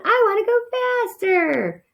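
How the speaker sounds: a tiny mouse bossing the driver; demanding little cousin. A narrator's reading. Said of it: "demanded the cousin".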